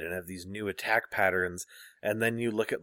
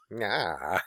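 ordinary talk, nothing marked

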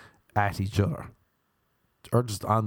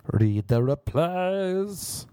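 slow, drawn-out and higher, like a grand announcement; 7 semitones higher, much slower than usual. Real, not acted. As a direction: stretch it out grandly.

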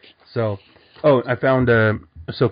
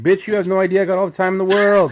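high, fast comic outburst; mock indignant retort; 8 semitones higher, faster than usual. Batman retorting about time, high mock indignation. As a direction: fire it back in mock indignation, pitched high.

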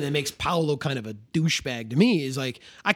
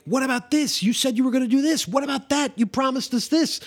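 much higher, pushy complaints; demanding villagers; 10 semitones higher than usual. Retold or imitated. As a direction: pile on the broken promises. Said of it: "campesinos coming to you and being like".

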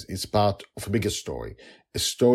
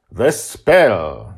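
grand wizard proclaiming; 10 dB louder than usual. Acted. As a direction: announce the ritual like a showman magician. Justin's ceremonial speech.

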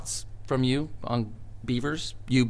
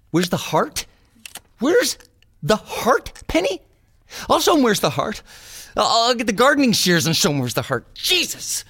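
loud, high, indignant rant; high-pitched wounded fury; outraged ranting; 10 semitones higher, 5 dB louder than usual. Acted. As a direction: throw the criticism back, incensed and rising. Outraged at the rejection note.

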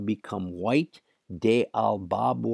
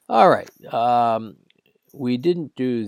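cracking voice; 2 semitones higher, 6 dB louder than usual. Real, not acted. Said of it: "forgive my cracking voice".

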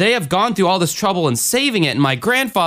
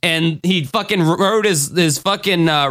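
normal talking voice

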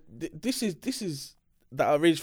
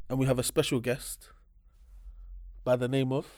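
normal talking voice

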